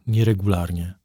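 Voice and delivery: usual speaking voice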